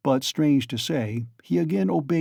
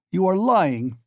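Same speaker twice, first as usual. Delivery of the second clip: higher, louder roar; 6 semitones higher, 4 dB louder, slower than usual. A narrator's reading. Raskolnikov roaring at Porfiri, unrestrained. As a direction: let it out unrestrained. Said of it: "roared Raskolnikov without restraint".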